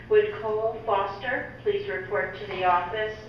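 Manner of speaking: usual speaking voice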